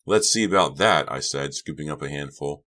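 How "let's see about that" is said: louder, higher retort; spirited comeback, voice raised; playful challenge. A narrator's reading. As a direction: dare him back loudly. Playful challenge before throwing.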